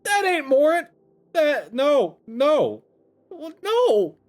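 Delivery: high, loud screamed refusal; shrieked denial. Acted. Desiree is told it's Morin, shrieking in denial. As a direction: shriek it in frantic disbelief.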